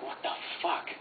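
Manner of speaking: breathless whispered disbelief; a kid stunned at a doll under the tree. Retold or imitated. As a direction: hiss it out in shock. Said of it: "And I'm like".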